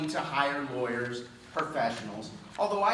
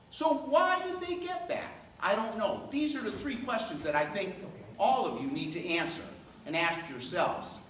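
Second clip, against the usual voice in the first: ordinary delivery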